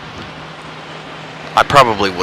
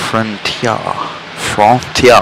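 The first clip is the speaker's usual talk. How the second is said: "Frontier" as French-style "fron-tyah"; mock French accent; much slower than usual. Shown as a demonstration. Teasing a chat viewer's question, "Frontier" in mock French. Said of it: "a French accent on frontier".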